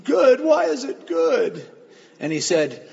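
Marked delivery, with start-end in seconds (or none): 0.0-1.7 high, loud, bewildered cry; imitated tearful protest. Crying husband challenging the pastor, tearful protest. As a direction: wail it slowly in mock disbelief. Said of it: "the guy's crying still".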